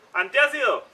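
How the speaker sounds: sudden loud call. Pretend. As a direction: shout it out urgently. Calls out for antacid.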